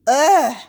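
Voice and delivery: a fed-up, revolted "ugh"; disgusted ugh. Real, not acted. Host fed up with a dense man, disgusted groan.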